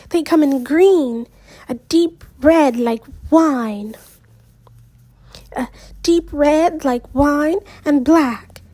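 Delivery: lightly gushing over sweaters; excited friend pushing turtlenecks. Acted. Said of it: "Meredith gushed".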